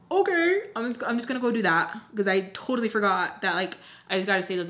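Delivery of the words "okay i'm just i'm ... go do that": exaggerated sarcastic acceptance. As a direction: fake chirpy compliance with useless advice. Sarcastic answer to useless advice.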